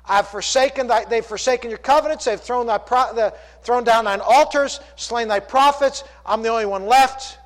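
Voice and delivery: loud Elijah's complaint from the pulpit; impassioned preaching. Acted. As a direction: preach it with rising urgency. Preaching at full force.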